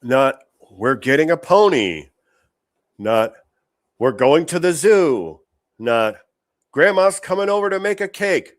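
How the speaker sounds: exasperated rant. Acted. Host contrasts sweet family news, loud and incredulous. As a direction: build the list in disbelief. Listing normal kid answers.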